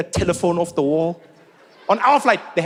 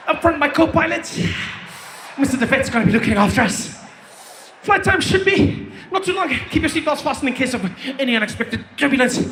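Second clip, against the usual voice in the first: panting pilot announcement; breathless pilot voice; 7 semitones higher than usual. Retold or imitated. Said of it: "like someone started".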